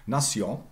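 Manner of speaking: NATION as "na-SYON"; French pronunciation. Shown as a demonstration. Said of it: "it's French".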